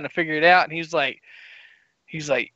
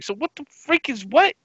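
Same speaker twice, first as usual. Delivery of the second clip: normal talking voice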